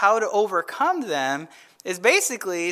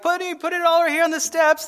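higher, quick, hammed-up greedy preacher pitch; mock TV preacher; 8 semitones higher, faster than usual. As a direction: ham it up like a televangelist begging for cash. Mocking money-hungry TV preachers.

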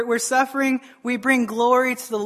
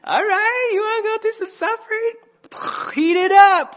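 God teasing, turning up the heat; mock God voice; 9 semitones higher than usual. God answers a prayer for glory, hammed-up and teasing. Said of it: "He's like".

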